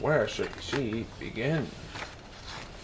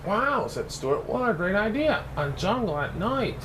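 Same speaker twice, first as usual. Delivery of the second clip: amazed; 8 semitones higher than usual. Acted. Stuart admires Regina's night jungle, high and delighted. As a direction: gush with delight.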